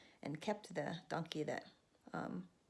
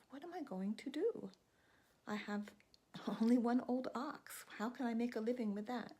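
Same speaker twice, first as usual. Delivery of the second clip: higher fretting voice; worried crazy brother; 6 semitones higher than usual. Acted. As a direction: fret aloud, a bit desperate. Crazy brother frets over ox.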